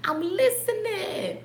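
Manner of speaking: whispered, slow and soft; hushed listening. Pretend. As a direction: hush it, as if really listening. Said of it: "I hear you".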